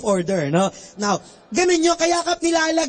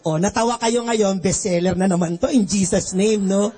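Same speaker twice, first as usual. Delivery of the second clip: normal talking voice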